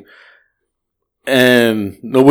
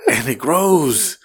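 very slow, softer drawn-out imitation; drunk philosophizing; 3 semitones higher, 5 dB quieter, much slower than usual. Driver imitating his drunk passenger philosophizing, slow and weighty. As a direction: draw it out like a drunk sage. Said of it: "he goes, all he talked about".

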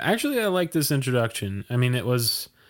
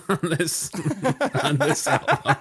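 real laughter breaking through high-pitched words; cracking up; 8 semitones higher than usual. Real, not acted. Reviewer giggling over his repeated album notes, cracking up. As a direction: lose it laughing mid-sentence.

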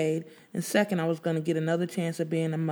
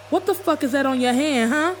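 angry confrontation; 8 semitones higher, 4 dB louder than usual. Acted. Confronting Dwayne in shop.

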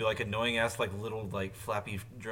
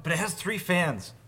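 a bit higher and louder, slowed down, mocking a friend; mock defensive quote; 8 semitones higher, 7 dB louder than usual. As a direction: quote his excuse in a teasing voice. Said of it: "You're like".

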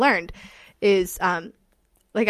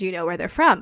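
normal talking voice

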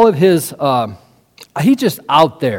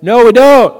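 loud, higher shouted reply; hollering back; 5 semitones higher, 7 dB louder than usual. Acted. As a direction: holler it back across a lake. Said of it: "they holler back across the lake".

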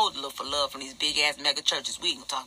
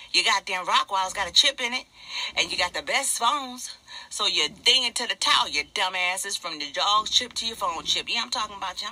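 high, heated ranting; worked-up, scolding tirade; mad; 5 semitones higher, 4 dB louder than usual. Real, not acted. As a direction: rant angrily, pitched well above your usual talk. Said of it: "I'm mad".

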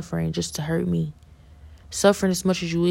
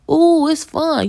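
high, slow sing-song parody, louder; mocking imitation; 10 semitones higher, 6 dB louder, much slower than usual. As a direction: mimic their careless glee. Mocking people who laugh along.

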